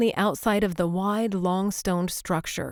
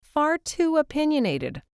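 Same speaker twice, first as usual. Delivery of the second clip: playful mock repeat, pitched up; teasing echo; 9 semitones higher than usual. A narrator's reading. Claire teases Liam by parroting him. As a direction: tease him by echoing his words. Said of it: "her desire to tease him".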